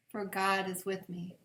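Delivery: usual speaking voice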